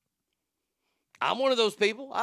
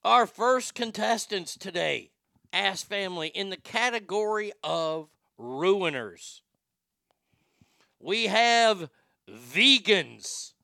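slow, deliberate announcer delivery; contest announcer; 4 semitones higher, 4 dB louder, slower than usual. Acted. Host introducing the first contestants, stretched-out announcer voice. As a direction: draw out each category like a ring announcer.

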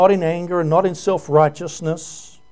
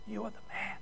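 near-silent, choked words; broken, hushed accusation; tearful; 4 semitones higher, 21 dB quieter than usual. Retold or imitated. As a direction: barely get it out through tears. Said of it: "with tears pouring down his cheeks".

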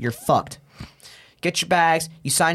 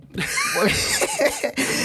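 high laughing fit. Real, not acted. Laughs at his own punchline.

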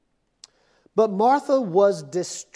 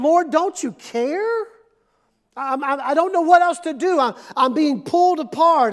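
raised, slowed desperate Lord; overwhelmed plea; 5 semitones higher, 4 dB louder than usual. Retold or imitated. Pastor voices his own stressed prayer, raised and desperate. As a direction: cry out in frustration. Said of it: "my thoughts immediately go".

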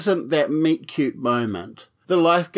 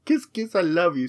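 high-pitched cutesy blown kisses; mock affection; 6 semitones higher than usual. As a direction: play-act a sugary hello to a sister. Mock greeting to sister's picture.

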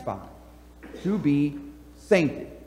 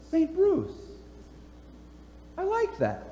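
high, soft, delighted imitation of Bruce; imitating Bruce; 11 semitones higher, 9 dB quieter than usual. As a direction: lift the voice up and hush it, pleased and surprised. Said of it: "And he goes".

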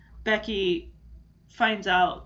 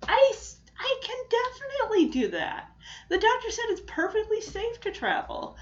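Becky quoted much higher, insisting; eager Becky; 11 semitones higher than usual. Retold or imitated. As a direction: pitch her up and plead she can go.

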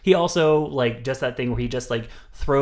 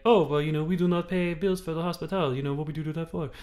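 put-on Nordic accent; 5 semitones higher than usual. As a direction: lightly imitate a Scandinavian interviewee. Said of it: "I can do that accent".

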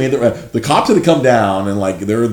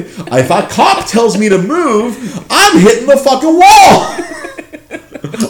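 high, loud, slow laughing shout; panic-run exaggeration; comic panic; 14 semitones higher, 6 dB louder, much slower than usual. Comic panic about cops.

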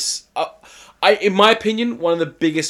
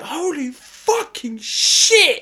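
drawn-out, much higher mock scream; shocked fan yelling at the TV, much higher; retold scream; 12 semitones higher, much slower than usual. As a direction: stretch it out high like you can't believe it. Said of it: "screamed it".

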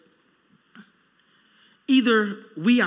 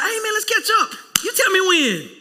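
breezy friend; 10 semitones higher than usual. Retold or imitated. Mimicking someone's empty promise.

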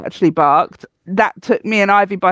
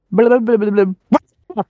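quick gabbled blah-blah impression of the old lady; mimicking mumbling woman; slower than usual. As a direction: gabble it like someone you can't understand. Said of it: "then she went".

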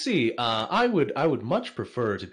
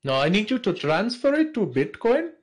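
higher-pitched, badly faked Nigerian accent; 10 semitones higher than usual. Scammer asking for a Bitcoin transfer, bad Nigerian accent. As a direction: attempt a hammed-up Nigerian accent. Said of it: "worst Nigerian accent I've ever heard".

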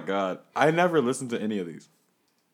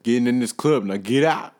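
bouncer; 9 dB louder than usual. Acted. Bouncer throws him out.

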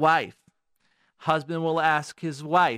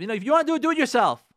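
mock outburst, much higher and louder; dismissive retort; 9 semitones higher, 5 dB louder, much faster than usual. Women telling their husbands off, dismissive retort. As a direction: throw it back at the husband, imitating. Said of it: "women who tell their husband".

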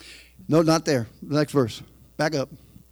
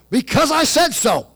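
stubborn spouse; 6 semitones higher than usual. Retold or imitated. Spouse ending the argument, a stubborn retort. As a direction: shut it down, end of discussion. Spouse quarrel back and forth.

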